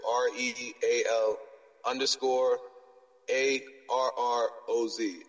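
normal talking voice

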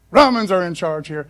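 usual speaking voice

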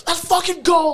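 high, loud burst of hype; hyped shout. Retold or imitated. Pumped-up lifter in his car, loud excited shout. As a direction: fire yourself up for the gym.

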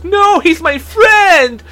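high, drawn-out imitated cry; mock desperate plea. Friend tackles him to protect his buddy, mock desperate cry.

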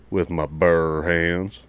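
loud, drawn-out Arkansas drawl; slow country twang. Retold or imitated. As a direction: stretch it out like a country recruit. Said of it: "Kinda slow and twangy and country".

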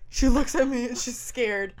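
usual speaking voice

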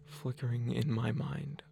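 quiet, subdued pleading in broken phrases; weary old father. Acted. Old sick father begs daughter to ask his wife, weary and soft. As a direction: speak softly, drained, with long pauses.

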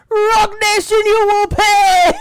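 very high, loud drawn-out holler; imitated yelling. Host imitating daddy at courtroom, high angry yell. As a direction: holler it as a mock threat. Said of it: "yelling at the news stations".

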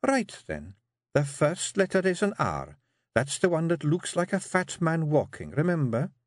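high Scots wifely voice; a firm wee wife steering her man; Jeanie coaxing. Acted. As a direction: Jeanie urging her husband to write.

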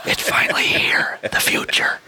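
hushed whisper of awe. Pretend. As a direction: whisper it like a grand reveal. Joking about 3D pens.

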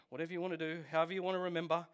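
normal talking voice

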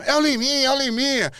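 high-pitched mock announcement of a name; Sérgio Malandro calling out. Sérgio Malandro tells the host who the kid is, high imitated call. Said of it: "o Sérgio Malandro".

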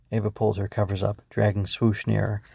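usual speaking voice

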